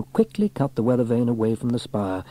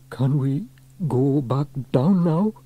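slow, higher nervous plea; nervous call; 4 semitones higher, slower than usual. A narrator's reading. Trevor up on the roof, calling nervously to Sam. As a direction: ask haltingly, wanting to get down. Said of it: "called Trevor nervously".

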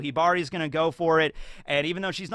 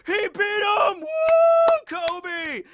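high, loud whooping cheer; crowd cheering; 7 dB louder, much slower than usual. Retold or imitated. The crowd cheering for Kobe, loud and high. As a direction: cheer like a whole arena. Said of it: "everyone's like".

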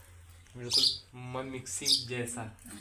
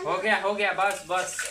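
higher and louder, hurried stop-stop call; urgent calling out; 6 semitones higher, 5 dB louder than usual. Real, not acted. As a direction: call out quickly to stop the pouring. Calling stop while sugar is added.

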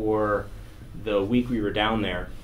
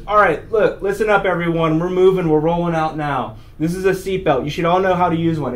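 loud, raised-pitch crew announcement; flight crew briefing; 6 semitones higher, 6 dB louder than usual. Retold or imitated. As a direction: call the whole cabin to attention. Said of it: "this dude gets up there in a blue flight suit".

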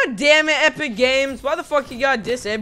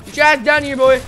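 loud, higher trash-talk shout; yelled taunt; 3 semitones higher, 7 dB louder than usual. Real, not acted. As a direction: call him out loud and cocky. Yelling at enemy player again.

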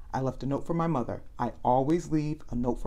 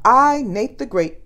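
louder, higher self-introduction; proud announcement; 7 semitones higher, 10 dB louder, slower than usual. Acted. Nate boasting to Annie, loud and proud. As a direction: announce your own name like a title.

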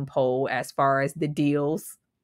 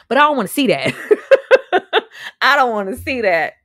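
emphatic refusal; 6 semitones higher, 8 dB louder, faster than usual. Real, not acted.

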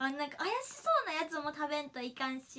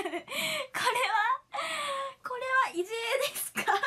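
high, loud, laughing protest; incredulous retold question, half laughing; mock disbelief; 9 semitones higher, 5 dB louder, faster than usual. MC facing a super-spicy cooking battle, mock disbelief.

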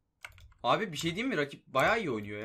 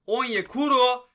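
higher, much louder, stretched slow; goal shout; 6 semitones higher, 8 dB louder, slower than usual. Real, not acted. Gamer yelling as Onyekuru scores, thrilled. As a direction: yell the name as it goes in.

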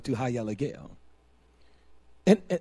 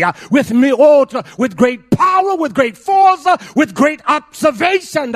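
loud, high-pitched preaching cadence; preaching at full force; 6 semitones higher, 9 dB louder than usual. Acted. Preacher to the congregation, at full force.

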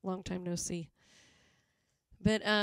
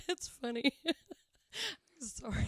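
laughing; 7 semitones higher than usual. Real, not acted. Still laughing after teasing co-host.

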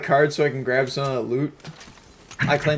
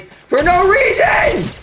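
rising into a high, slow scream; 5 dB louder, much slower than usual. Real, not acted. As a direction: build to a real frustrated scream on the last words. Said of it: "you are listening to me scream my balls off".

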